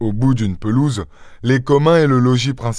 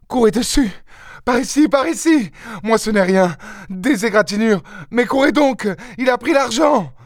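very high, urgent short bursts; frantic faltering cries; 11 semitones higher than usual. A narrator's reading. Wounded mother sending husband and sons after thief, frantic. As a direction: gasp out orders in a thin high pitch. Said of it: "le bras tendu, bégaya".

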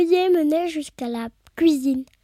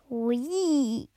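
soft, lower, drawn-out relish; gloating glee; 3 semitones lower, 6 dB quieter than usual. A narrator's reading. Witch delighted that Pierre finds her soup disgusting, jubilant. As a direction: savour the word with quiet delight. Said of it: "répondit Cornebidouille en jubilant".

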